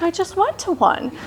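high-pitched mock complaint; whiny protest. Newcomer told to attend another meeting, whiny mock protest. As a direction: whine it back, poking fun at yourself.

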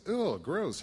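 high squeal of disgust; mock disgust. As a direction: recoil from the fly in the beer. Heavy drinker sees fly.

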